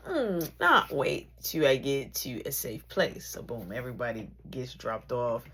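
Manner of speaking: normal talking voice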